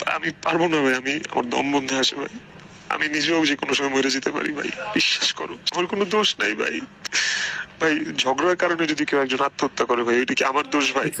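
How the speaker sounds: high, breaking voice begging; pleading through tears, voice cracking; desperate crying plea. Real, not acted. Desperate plea, can't breathe. (কান্নাভেজা কণ্ঠে অনুনয়)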